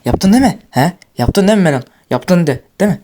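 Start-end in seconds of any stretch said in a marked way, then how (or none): none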